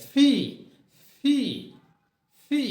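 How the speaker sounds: ফি, said with a forceful burst of breath; aspirated pee. Shown as a demonstration. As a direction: blow ফি out hard. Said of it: "জোরে একটা বাতাস বের হয়". (জোরে বাতাস ছেড়ে ফি)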